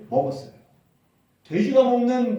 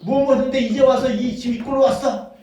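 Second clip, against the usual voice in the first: louder, higher accusing rant; an angry father interrogating his returned son; scolding father; 5 semitones higher, 7 dB louder than usual. Acted. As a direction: demand answers like a furious father. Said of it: "이렇게 따지는 것이 아니라".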